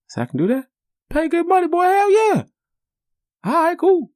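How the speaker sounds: put-on very high, loud kid's voice; squeaky excited kid. Little boy to his dad at the match, high thrilled squeak.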